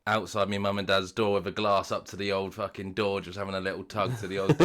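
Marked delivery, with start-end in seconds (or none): none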